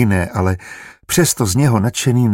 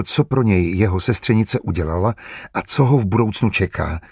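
ordinary delivery, nothing marked

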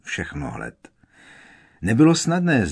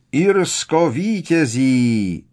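louder, slow stretched announcer cry; announcer shout; 5 semitones higher, 5 dB louder, much slower than usual. A narrator's reading. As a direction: boom it out like a commentator. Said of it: "vykřikl Pitloun". (hlasatelský výkřik)

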